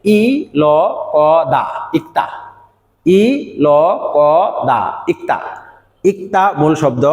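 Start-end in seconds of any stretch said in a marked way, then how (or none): 0.0-2.3 "ই ল ক দা" letter by letter, then "ইক্তা" whole; chanted spell-out of "ই ল ক দা", ending on "ইক্তা"; spelling aloud. Shown as a demonstration. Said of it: "আমরা এটাকে বানান করি". 3.0-5.4 "ই ল ক দা" with each sound separate, then "ইক্তা"; spaced-out "ই ল ক দা", then the word "ইক্তা"; spelling aloud. Shown as a demonstration. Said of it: "ছাত্রদেরকে এইভাবে শেখাই".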